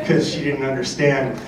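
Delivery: ordinary talk, nothing marked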